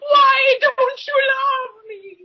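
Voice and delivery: slow, high, loud mock wail; screaming characters. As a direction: drag out a hammed-up, needy scream. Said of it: "all those assholes screaming at me".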